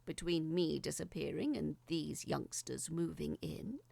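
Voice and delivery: usual speaking voice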